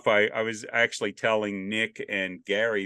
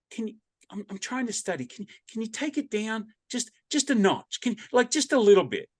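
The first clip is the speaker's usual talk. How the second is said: higher, mostly hushed, asking to turn it down; imitating young lady; 10 semitones higher than usual. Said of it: "this, uh, young lady came up to me and she goes".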